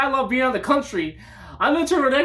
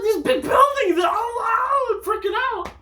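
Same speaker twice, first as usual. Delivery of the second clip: overwhelmed shout; 10 semitones higher, slower than usual. Retold or imitated. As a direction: cry out at the giant buildings. Retelling awe at city buildings.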